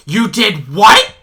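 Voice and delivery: shocked mother. Retold or imitated. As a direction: mom hearing what her son did, shocked and loud. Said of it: "and she was like".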